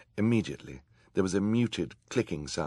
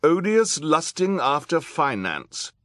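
high, loud proclamation; reading out loud; 11 semitones higher, 9 dB louder than usual. A narrator's reading. Judge reading the damning book title aloud, high loud declaiming. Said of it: "she read out loud".

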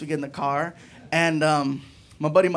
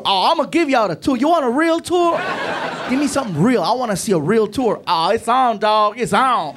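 street driver; 5 semitones higher, 5 dB louder than usual. Retold or imitated. Driver getting comfortable.

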